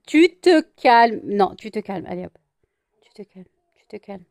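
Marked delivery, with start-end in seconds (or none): 0.1-1.2 loud, high, drawn-out command; firm scolding. Real, not acted. Owner telling pet to settle, loud slow firm order. 3.0-4.2 very soft, low-key command; hushed settling. Real, not acted. Owner settling excited pet, hushed soft order.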